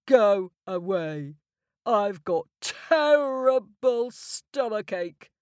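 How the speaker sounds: slow, grumpy giant. Acted. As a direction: groan it like a sick giant. Sick giant grumbles.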